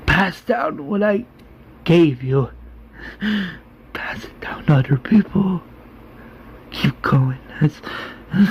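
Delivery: halting last words through failing breath; acted weak, breath-broken deathbed voice; dying, slow speech. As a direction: gasp out parting advice, fading at the end. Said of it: "slowly says".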